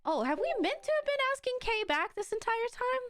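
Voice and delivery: high, breezy mock realization; light imitation of a clueless organizer. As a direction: sound oblivious and surprised. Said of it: "they're just like".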